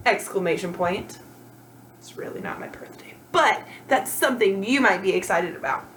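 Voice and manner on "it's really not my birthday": a quick whispered confession; whispered aside. Real, not acted. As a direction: whisper it as a secret to the class. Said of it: "whispered aside".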